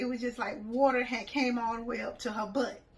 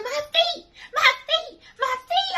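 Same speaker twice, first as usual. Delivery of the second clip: very high, loud wailing whine; whiny complaint; 10 dB louder than usual. Retold or imitated. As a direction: wail about freezing feet. Friend whining about freezing feet.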